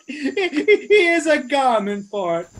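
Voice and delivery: ordinary talk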